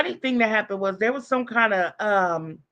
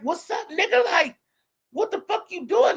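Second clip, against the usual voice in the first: mock alarmed, pitched up high; alarmed greeting; 8 semitones higher than usual. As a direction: imitate a man who suddenly sees a gun. Victim alarmed, sees the gun.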